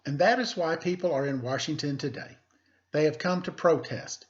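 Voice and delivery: teacher reading a picture book aloud; story reading. Acted. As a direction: clear read-aloud storytelling voice.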